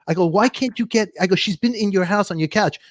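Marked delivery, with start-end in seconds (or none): 0.3-1.1 higher, mildly exasperated mock complaint; acted-out indignant pleading; retold indignation. Acted complaint to show host. 1.4-2.8 higher, mildly exasperated mock complaint; acted-out indignant pleading; retold indignation. Acted complaint to show host.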